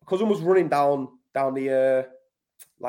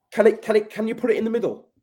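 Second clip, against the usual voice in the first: higher, urgent, tumbling words; retold pleading, pitched up and pressing; eager plea; 7 semitones higher, faster than usual. Retelling begging the mechanic to use the middle gear, excited and higher.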